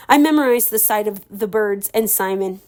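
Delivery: ordinary delivery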